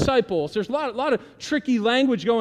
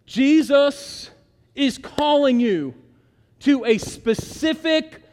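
loud, slow, higher proclamation; urgent exhortation; 6 semitones higher, 6 dB louder, slower than usual. Acted. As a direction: drive each word home.

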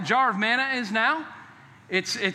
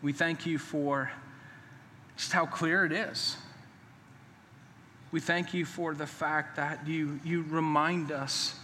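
soft, lower pastoral prayer voice; closing prayer; 7 semitones lower, 7 dB quieter than usual. Acted. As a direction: pray quietly over the congregation.

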